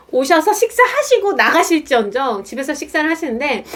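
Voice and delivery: ordinary delivery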